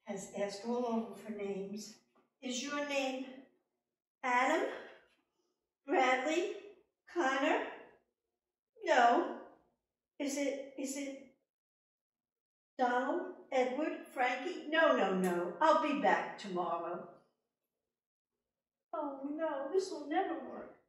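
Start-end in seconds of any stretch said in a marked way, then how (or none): 2.4-14.7 high, loud, hopeful guesses; queen guessing the little man's name. Acted. 14.7-17.1 gleeful little man. Acted. 19.0-20.8 despairing queen. Acted.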